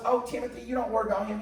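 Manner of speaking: put-on breezy, unbothered older lady; Auntie Deborah. The auntie brushes off her nephew's worry, in a high easygoing voice. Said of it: "She goes".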